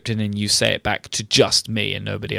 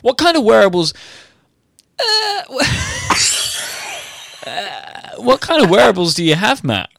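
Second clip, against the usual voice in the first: talk show host; 8 semitones higher than usual. Acted. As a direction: overplay the perky interviewer. Mock news host segment.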